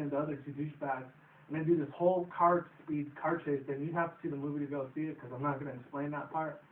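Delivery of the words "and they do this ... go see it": ordinary delivery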